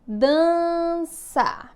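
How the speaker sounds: DANÇA stretched into long syllables; sustained classroom dictation of dança. Shown as a demonstration. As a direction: dictate "dança" slowly, dan-ça. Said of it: "eu vou ditar algumas palavrinhas".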